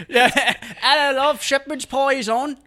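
high, slow mock British voice; British accent. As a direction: ham up a British lover. Mock British sex talk.

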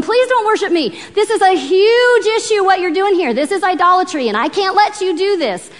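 high-pitched pleading protest; urgent begging. Acted. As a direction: plead urgently with an adoring crowd. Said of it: "Paul's urgently begging them".

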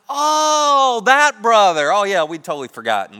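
huge drawn-out high, loud exclamation; hammed-up sudden remembering, then settling down; mock realization. Brothers pretend to recall Joseph, mock realization.

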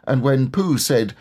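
plain reading voice

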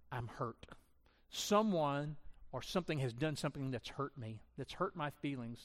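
ordinary delivery

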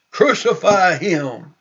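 mildly shouted demand; retold crowd cry. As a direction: call it out like one of a crowd. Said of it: "And they cried".

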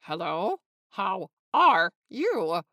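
questioning hello, then slow, separated "How are you"; careful, drawn-out rehearsal of a greeting; tentative practice greeting. A narrator's reading. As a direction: try it unsure, word by word. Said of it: "He liked the last one best".

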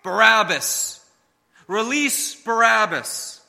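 loud, slow chanted yell; crowd yelling. Retold or imitated. Crowd yelling for the prisoner's release, loud mob chant. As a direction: bellow it like a mob. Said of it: "yelling as with one voice".

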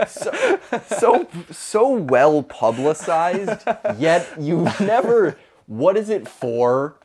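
slow, high, drawn-out puzzlement; mock wonder. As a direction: sound baffled and amazed. Mock wonder about bull running.

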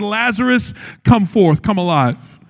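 ordinary talk, nothing marked

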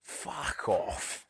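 hushed, breathy brush-off; whispered aside. Real, not acted. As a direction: whisper it under your breath.

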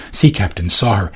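usual speaking voice

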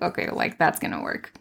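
whispered, doubtful aside; unvoiced skeptical mutter; sarcastic whisper. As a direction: scoff under your breath that it will never work. Sarcastic doubting reply to study.

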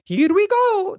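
very high cartoon catchphrase voice; squeaky video game character impression; Mario. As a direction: pitch it way up like a game hero. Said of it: "what his voice sounds like".

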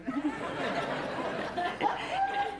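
high bursts of real laughter; laughing. Real, not acted. Laughing at her own confession.

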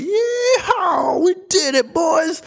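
very high shouted yeehaw; whooping cowboy fly. Pretend. Fly cheering to its boys after squeezing through the crack, high whooping shout. Fly voiced celebrating entry.

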